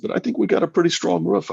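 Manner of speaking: high, playful mock-innocent voice; a sly playful lilt; teasing reply. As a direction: tease her with a lifted voice. Retelling his joke to Wendy.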